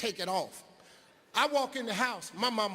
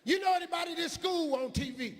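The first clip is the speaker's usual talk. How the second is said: much higher, disapproving teacher voice, imitated; high mock teacher; 10 semitones higher, faster than usual. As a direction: play the shocked teacher, pitched up high. Teacher voice in story.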